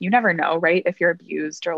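ordinary delivery

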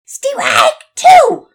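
very loud, high umpire's call; big shouted ballgame call; umpire yell. A narrator's reading. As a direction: bellow it out across the field. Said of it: "hear the umpire yell".

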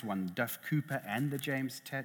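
normal talking voice

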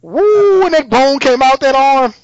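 high, loud whoop into the retelling; excited amazement. Real, not acted. Fan retelling a bone breaking through an arm, excited whoop. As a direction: exclaim it, thrilled by the gore.

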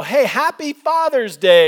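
loud, high and drawn-out greeting; cheery holiday greeting. Retold or imitated. Son phoning dad on Father's Day, cheery. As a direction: deliver it slowly in a high, hammed-up cheer.

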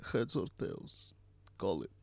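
softly voiced coin-toss menace; Anton Chigurh. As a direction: lightly play a quiet, weird villain. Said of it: "him flipping the coin".

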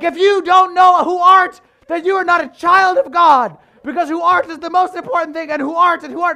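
hammed-up fire-and-brimstone shout; high, very loud, hammering preacher; pounding it in. Preacher re-enacting his mistaken sermon, thundering. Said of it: "I'm pounding it in their heads".

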